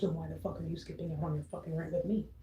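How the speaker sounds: normal talking voice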